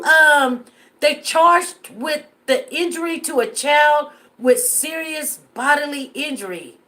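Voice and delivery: normal talking voice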